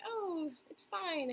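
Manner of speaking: high, slow mock reassurance; imitating dismissive doctors. Doctors telling a patient it's fine, high drawn-out imitation. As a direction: draw it out high, brushing the patient off. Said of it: "when doctors are like".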